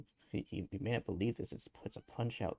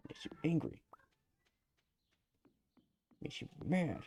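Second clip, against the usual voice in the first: mock angry; 6 semitones higher than usual.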